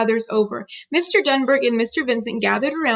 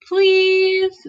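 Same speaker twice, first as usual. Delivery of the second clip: put-on pleading toddler; 8 semitones higher, 5 dB louder than usual.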